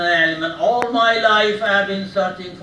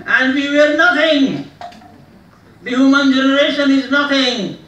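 loud, high, ringing pronouncement; raised-voice cry landing on "nothing"; impassioned declaration; 7 semitones higher, 10 dB louder than usual. Acted. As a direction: proclaim it at full oratorical force. Oratory, voicing Freud's view as quoted.

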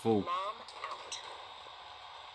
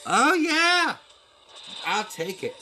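excited cheer; 14 semitones higher, 6 dB louder, slower than usual. Real, not acted. Finally gets wanted item.

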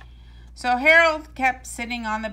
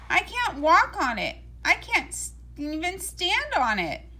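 pained complaint; 7 semitones higher than usual. A narrator's reading. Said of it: "said Big Leon".

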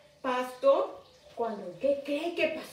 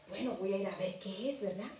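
soft, hushed, curious aside; 3 semitones lower, 9 dB quieter, faster than usual. Retold or imitated. Teacher voicing curious Moses to the children, soft and hushed. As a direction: murmur it to yourself, drawn in.

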